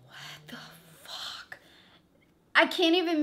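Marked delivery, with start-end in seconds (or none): 0.0-1.6 breathless whispered what the fuck; barely voiced, dazed disbelief; stunned whisper. Collapsing on couch, stunned.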